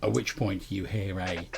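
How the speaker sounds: ordinary talk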